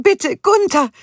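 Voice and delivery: high, loud desperate plea; pleading. A narrator's reading. Judith begging Gunther to act, high loud desperate plea. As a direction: beg him urgently. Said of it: "flehte sie".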